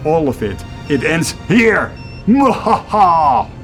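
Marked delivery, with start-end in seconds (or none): 2.3-3.5 slow, hammy villain cackle; evil laugh. Acted. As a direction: cackle in triumph.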